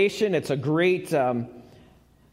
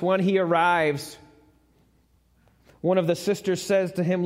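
normal talking voice

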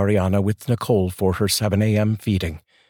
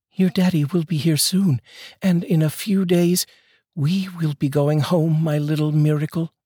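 higher, gentle voice for a new mother; tender young mother; 8 semitones higher than usual. Acted. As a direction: cradle the words like a sleeping baby. Mother speaks to her newborn.

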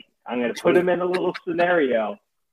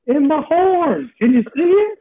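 narwhal voice; 6 semitones higher than usual. Pretend. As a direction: play a goofy cartoon narwhal. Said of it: "the narwhal voice".

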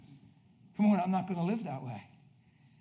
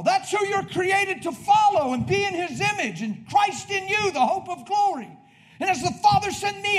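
loud, high, fervent pulpit preaching; preaching at full force; 12 semitones higher, 11 dB louder than usual. Acted. Preacher rallying the congregation, full-force preaching. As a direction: thunder it out to the congregation.

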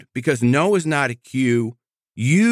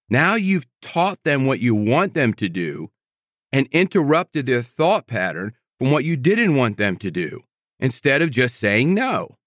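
ordinary talk

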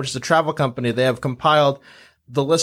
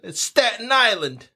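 high mock Staten Island accent; hammed-up Staten Island New Yorker; 8 semitones higher than usual. Shown as a demonstration. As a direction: call out the borough in a put-on local accent. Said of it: "they all speak that way".

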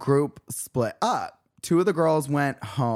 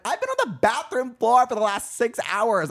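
retold protest; 9 semitones higher than usual. Retold indignant protest to friend.

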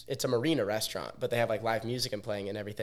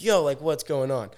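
raised, higher voice, mildly acted; retold alarmed protest; 4 semitones higher, 5 dB louder than usual. Daniel protesting as his friend is choked, retold raised-voice alarm. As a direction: challenge them in mock alarm.

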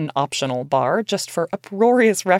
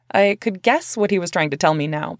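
ordinary delivery, nothing marked

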